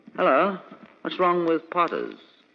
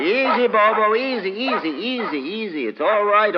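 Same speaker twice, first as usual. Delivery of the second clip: high, coaxing calm; soothing the dog; 8 semitones higher, 3 dB louder than usual. Acted. Doctor calms the dog after forcing the door, soothing. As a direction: settle a frightened dog.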